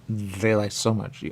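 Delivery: ordinary talk, nothing marked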